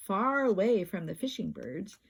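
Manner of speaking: usual speaking voice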